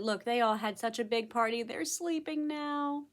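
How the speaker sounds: plain reading voice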